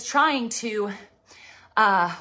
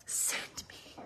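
barely voiced, reverent whisper; whispered plea; 16 dB quieter than usual. Preacher voicing a prayer to God, whispered plea. Prayer quote, first said hushed.

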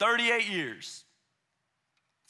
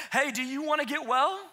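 breezy, offhand and a touch raised; casual blunt question; 6 semitones higher than usual. Retold or imitated. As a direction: toss it off like it's obvious. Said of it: "Jesus has the nerve to walk up and say".

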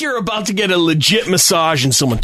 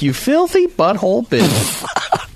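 slow, higher-pitched mock insult; drawn-out imitation; 6 semitones higher, slower than usual. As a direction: savour every word of the imitated insult. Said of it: "then he was like".

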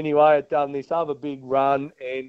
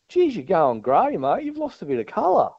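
teasing mate; 7 semitones higher than usual. Retold or imitated. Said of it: "he goes".

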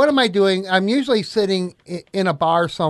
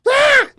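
excited yell; 8 dB louder than usual. Retold or imitated. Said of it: "I'm like".

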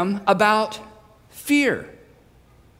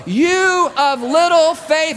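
long, slow shout pitched high; stretched-out high yell; 8 semitones higher, 4 dB louder, much slower than usual. Acted. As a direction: bellow each word to carry over the storm. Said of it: "He's having to yell this".

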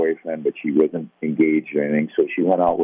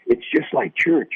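put-on earnest kids; 13 semitones higher, slower than usual. Boys inviting him to chapel.

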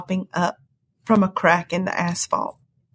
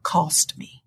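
hushed; 4 semitones lower, 4 dB quieter than usual. Retold or imitated.

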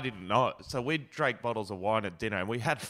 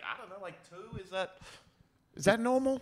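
mock innocent; 9 semitones higher than usual. Retelling his answer to the bouncer, high and innocent. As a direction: play dumb like you really don't know. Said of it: "I was like".

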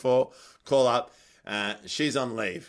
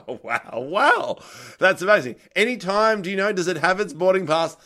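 sarcastic amazement; 6 semitones higher, 4 dB louder than usual. Retold or imitated. Sarcastic reaction, parcel at the airport.

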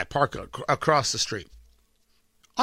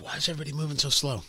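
rushed irritation; 6 semitones lower, 7 dB quieter, much faster than usual. Retold or imitated. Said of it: "Today, I'm like".

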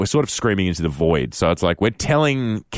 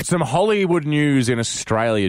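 radio host intro; 6 semitones higher than usual. Acted.

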